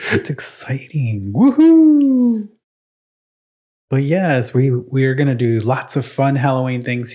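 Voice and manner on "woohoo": cheer. Acted. Mock cheer.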